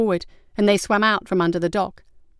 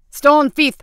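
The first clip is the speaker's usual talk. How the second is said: loud, high cry of accusation; shouted alarm; 7 semitones higher, 7 dB louder than usual. A narrator's reading. Captain spotting the glowing soulstone, shouting in alarm. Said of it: "the captain shouted".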